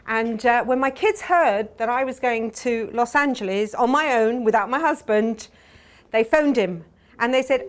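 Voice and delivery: usual speaking voice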